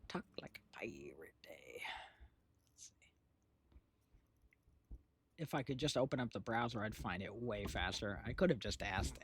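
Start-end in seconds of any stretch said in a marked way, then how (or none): 0.1-2.9 soft whisper to herself. Real, not acted. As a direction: whisper it while typing. Whispered search typing.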